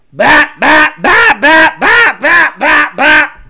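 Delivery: loud staccato volley of bats; imitated engine bangs. Tuner mimicking a car's pops, loud imitated bangs.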